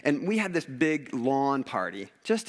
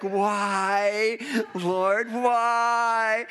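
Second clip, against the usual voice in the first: loud, high, drawn-out plea; mock anguished wail; 9 semitones higher, 5 dB louder, much slower than usual. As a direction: cry out to heaven in hammed-up despair. Acts out despairing prayer.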